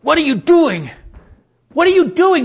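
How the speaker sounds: loud, high-pitched indignant yelling; angry outburst. Retold or imitated. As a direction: blow up at him in anger. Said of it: "got this angry face".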